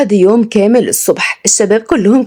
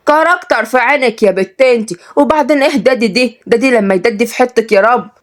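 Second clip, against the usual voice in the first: higher-pitched indignant Sa'idi woman; angry; 5 semitones higher than usual. Acted. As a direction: snap back at a snooty girl. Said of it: "حطت ايديها في وسطها بغضب". (صعيدية غضبانة بصوت عالي الطبقة)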